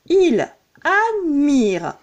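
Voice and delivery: il admire in slow separate beats; slow and deliberate verse scansion; syllable counting. Shown as a demonstration. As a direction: scan il, ad, mire one syllable at a time. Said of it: "La dernière syllabe contenant ce E".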